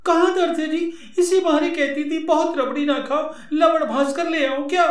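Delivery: high woman's voice, scolding care; wife voice. Acted.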